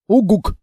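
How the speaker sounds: short loud rising hoot; owl-like hoot. Acted. Monster hoots a loud reply to the girl. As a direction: hoot like a big friendly monster.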